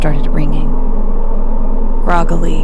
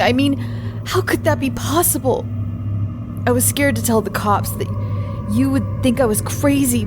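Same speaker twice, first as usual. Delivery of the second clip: tearful, high and trembling; frightened young man close to tears; crying; 4 semitones higher, 5 dB quieter than usual. Acted. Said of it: "He was crying now".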